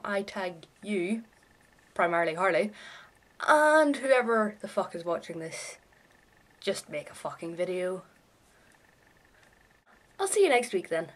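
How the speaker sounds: ordinary talk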